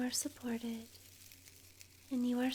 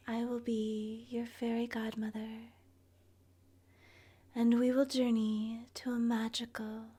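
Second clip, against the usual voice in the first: usual speaking voice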